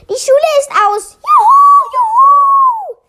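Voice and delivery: high, loud jubilant whooping; shouted cheer. A narrator's reading. All pupils cheer that school is over, shouting. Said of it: "schrien alle".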